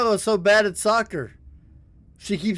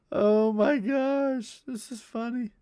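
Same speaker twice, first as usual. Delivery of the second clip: laughing; 5 semitones higher than usual. Real, not acted. Laughing after the brick joke.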